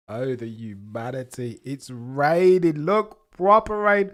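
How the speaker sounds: hammed-up wail, rising high, loud and slow; milk the rain like a tragedy; mock dramatic despair. Streamer mourning the rain before the race, hammed-up wail.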